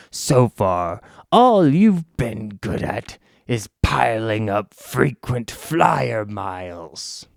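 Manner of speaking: slow, mocking villain sneer; lightly voiced villain. Villain mocking the heroes' travel, slow and sneering. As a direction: drag out the insult slowly.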